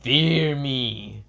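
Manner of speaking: slow, drawn-out menacing snake. Acted. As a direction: stretch each word like a menacing snake. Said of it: "of course, the snake says".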